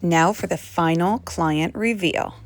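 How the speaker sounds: slow, grand reveal voice, lightly hammed; slow teasing show-host reveal before the joke; mock announcer. Mock reveal, then "just joking.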